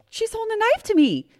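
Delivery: high, urgent acted begging; high-pitched report of panic, played up; retold frantic plea.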